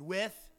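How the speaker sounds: "with" lifted in pitch and set apart; stress on "with". Shown as a demonstration. Preacher explaining the English phrase, stress on "with". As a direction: give "with" a rising emphasis. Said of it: "and you emphasize the word".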